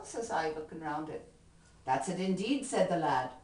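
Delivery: lively Irish ballad recitation, rhythmic; poem recitation. Acted. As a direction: perform the comic poem with swing.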